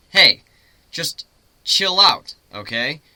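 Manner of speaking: loud, slow mock-casual chill out; joking tease. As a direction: land a cheesy pun on the frozen pony. Pun joke to frozen unicorn.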